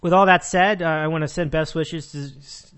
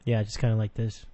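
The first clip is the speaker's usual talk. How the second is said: dull host voice; 6 semitones lower than usual. Shown as a demonstration. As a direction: keep the boring low radio voice going. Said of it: "they talk a little bit like this".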